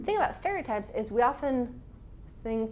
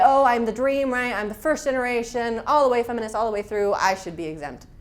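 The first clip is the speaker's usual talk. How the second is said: loud, self-satisfied boast of being the dream; smug proud declaration; 7 dB louder than usual. Retold or imitated. Young feminists boasting to each other, loud and smug. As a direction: proclaim it proudly, chest out. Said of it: "And we would say".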